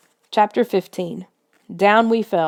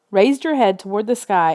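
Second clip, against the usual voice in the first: ordinary delivery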